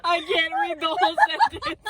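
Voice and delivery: high-pitched words breaking up with laughter; helpless laughter. Real, not acted. Cracking up over an endless sentence, helpless laughter. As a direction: laugh so hard you can barely finish. Cracking up while reading aloud.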